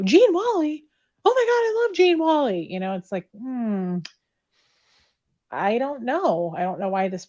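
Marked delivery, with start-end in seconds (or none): 0.0-2.7 much higher and louder, mock adoring squeal; mock gushing fan. Mocking callers who adore Gene Wally, gushing fan voice. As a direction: gush like a starstruck fan, hammed up. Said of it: "people call me and go".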